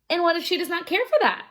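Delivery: fast, high indignant question; eager question. Acted. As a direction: rush it out in disbelief.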